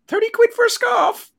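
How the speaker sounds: very high, loud squawked impression; mock outraged Donna. Host mimicking Donna catching out Trev over a scarf, high mock shriek. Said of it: "the beautiful Donna, and she gone".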